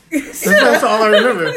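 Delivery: loud laughing through words. Real, not acted. Laughing aside.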